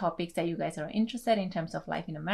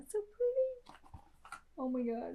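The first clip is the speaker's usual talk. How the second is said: much higher, softer swoon over the colors; delighted gush; 13 semitones higher, 4 dB quieter than usual. Real, not acted. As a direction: coo over them, enchanted. Gushing over packaging.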